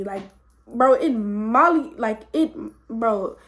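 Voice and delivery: ordinary talk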